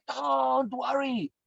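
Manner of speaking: "Don't worry" barked high, loud and very slow; jump-scare yell at a listener; scary shout. Shown as a demonstration. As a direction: scream it to frighten someone. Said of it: "scare the hell out of you".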